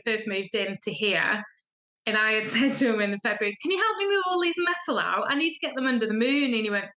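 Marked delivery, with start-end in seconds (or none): 3.6-6.5 bright casual request. Retold or imitated. Acting out her request to husband.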